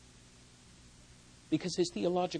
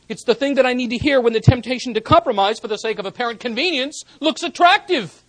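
loud, much higher, fast build; rising preaching climax; 7 semitones higher, 15 dB louder than usual. Acted. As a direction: build the sermon to a ringing peak. Sermon building to full force.

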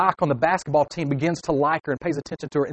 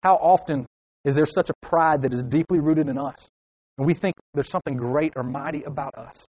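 ordinary talk, nothing marked

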